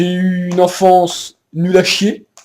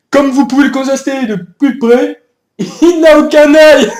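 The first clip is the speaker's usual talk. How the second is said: laughing; 7 semitones higher than usual. Acted. Sketch reporter with blind dog.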